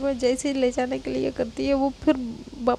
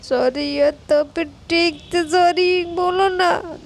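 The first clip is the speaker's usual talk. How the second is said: put-on whiny, tearful child demanding; whining demand; 3 semitones higher than usual. Girl demanding a proper apology, whiny and tearful. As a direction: whine it like a pouting kid. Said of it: "तो कहती".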